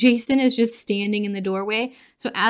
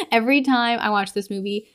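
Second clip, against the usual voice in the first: ordinary delivery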